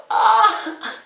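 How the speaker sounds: high, loud delighted "ah"; happy squeal. Retold or imitated. Candidate hearing she got the job, squealing happily. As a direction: let out a high squeal of joy. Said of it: "I was so happy".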